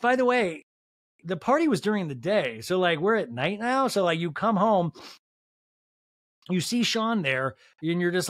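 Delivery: ordinary delivery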